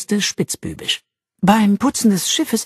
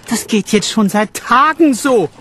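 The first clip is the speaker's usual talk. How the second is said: higher, louder complaint; complaining noble, higher and louder; 7 semitones higher, 4 dB louder than usual. Acted. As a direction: whine about the heat. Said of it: "beklagte er sich".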